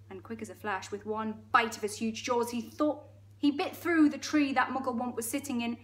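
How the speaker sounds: plain reading voice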